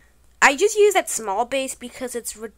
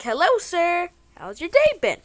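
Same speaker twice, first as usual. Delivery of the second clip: higher, louder, overly polite customer voice; fake nice greeting; 7 semitones higher, 6 dB louder than usual. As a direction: greet like a sweet innocent stranger. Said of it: "Like a nice, normal person".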